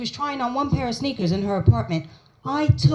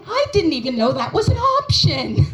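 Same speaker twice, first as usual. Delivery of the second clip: baffled; 7 semitones higher than usual. Retold or imitated. Comic reaction.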